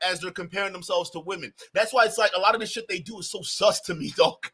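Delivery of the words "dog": normal talking voice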